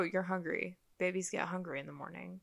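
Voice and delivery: soft questioning tune, rising on "morning". Retold or imitated. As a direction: make "Babies get hungry in the morning" a quiet question, lifting at the end. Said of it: "he says it, like, as a question".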